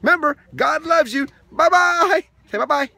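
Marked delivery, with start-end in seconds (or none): none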